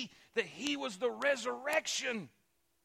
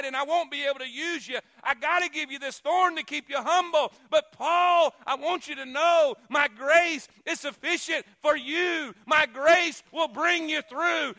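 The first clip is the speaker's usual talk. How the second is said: loud, high, ringing preacher cry; preaching at full force; 5 semitones higher, 10 dB louder than usual. Acted. Preacher voicing God's answer to Paul, shouting. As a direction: proclaim God's answer like a trumpet.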